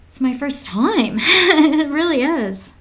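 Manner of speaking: giggling through the words; can't stop laughing at her own joke. Real, not acted.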